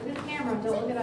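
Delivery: very soft, low aside; hushed prompt. Real, not acted. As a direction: slip them a quiet stage direction.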